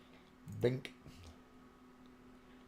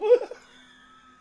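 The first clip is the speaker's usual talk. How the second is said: loud, high burst of laughter; shrieking laugh; 14 dB louder than usual. Real, not acted. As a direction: howl with laughter.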